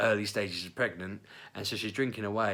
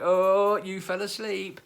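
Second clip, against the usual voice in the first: teasing; 10 semitones higher, 6 dB louder, much slower than usual. Retold or imitated. Colleagues teasing.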